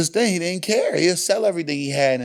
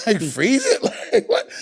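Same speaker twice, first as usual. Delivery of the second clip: much higher laughing disbelief; incredulous laugh; 10 semitones higher than usual. Real, not acted. Host laughing about his dad freezing pizza.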